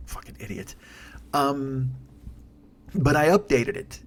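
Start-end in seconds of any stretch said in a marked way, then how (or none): 0.1-0.8 muttered whisper, far softer, no voice at all; whispered insult. Real, not acted. Podcaster whispers an insult at the laptop he just punched. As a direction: hiss it under your breath at the laptop.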